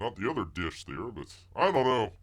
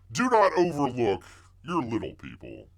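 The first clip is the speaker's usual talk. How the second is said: normal talking voice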